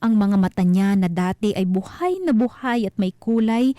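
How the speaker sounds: usual speaking voice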